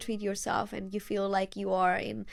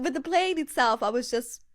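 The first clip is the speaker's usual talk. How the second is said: usual speaking voice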